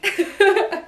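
short, high giggle; quick laugh at your own silliness. Real, not acted. Amused by own body rhyme, short giggle.

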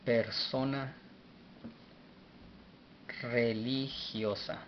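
"Persona religiosa" slowly, with long pauses; slow, broken up. Shown as a demonstration. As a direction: draw out "religiosa" syllable by syllable. Teacher repeats translation slowly for learners. ("Persona religiosa" despacio, por sílabas)

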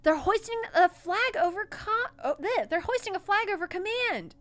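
loud, high, thrilled yelling; excited shout. Acted. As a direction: shout it with glee. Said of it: "clapping her hands excitedly".

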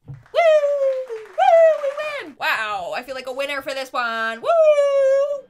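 high, loud victory whoops; triumphant cheering. Real, not acted. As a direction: celebrate a win out loud. Cheering the happy result.

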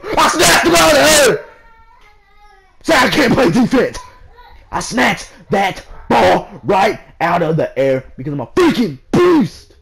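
loud triumphant yelling, slowing on beast; hyped screaming. Real, not acted. Gamer screaming at his own rebound, loud and hyped. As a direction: bellow it like you just stole the ball. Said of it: "I'm sorry I'm screaming".

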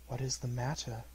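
a gentle ghost asking calmly; lightly voiced spirit. A narrator's reading. Spirit speaks.

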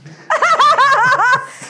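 loud high cackle; big laugh. Real, not acted. Joking about pitying Jesus, a loud high laugh. Laughs at her own joke.

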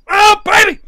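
high, loud burst of excitement; thrilled yell. Real, not acted. Hunter thrilled by the prey, excited yell. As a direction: whoop it out suddenly. Thrilled while stalking the animal.

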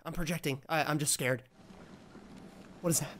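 normal talking voice

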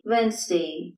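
"വെൻസ്ഡേ" as WENZ-day, first D silent; correct pronunciation. Shown as a demonstration. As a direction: say "വെൻസ്ഡേ" in two syllables. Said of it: "ഇവിടെ ഡി silent ആണ്". ("വെൻസ്ഡേ" ശരിയായി, ആദ്യ "ഡി" ഇല്ലാതെ)

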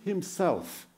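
himself lifted high and leaned on; stress on himself. Shown as a demonstration. Preacher pointing the congregation to himself, stressed. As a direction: lean on himself in the verse. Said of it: "Emphasis on himself".

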